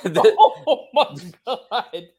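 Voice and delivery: shocked laugh, high; cracking up at the joke; laughing in disbelief. Real, not acted.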